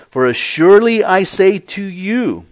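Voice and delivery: high, loud, solemn declaration; emphatic pronouncement. Acted. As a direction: proclaim it forcefully from the pulpit. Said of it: "the emphasis is on the words that follow".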